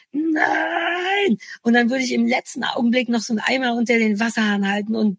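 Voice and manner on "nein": high, drawn-out slow motion no. Pretend. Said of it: "aber in Zeitlupe natürlich".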